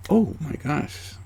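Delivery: slow, high, hushed oh my gosh; dawning realization. Retold or imitated. Yeller realizes own yelling, slow and hushed. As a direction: let it dawn slowly, a bit high.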